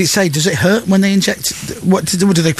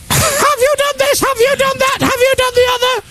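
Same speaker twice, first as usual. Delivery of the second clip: nagging voice. Retold or imitated. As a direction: moan like a fussy organiser on the phone. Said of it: "they're moaning all the time".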